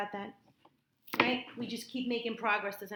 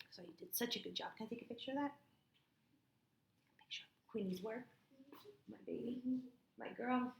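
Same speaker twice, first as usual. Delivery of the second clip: soft, hushed fond praise; tender praise; 3 semitones higher, 11 dB quieter than usual. Real, not acted. Tutor softly praising Queenie's work like a mother.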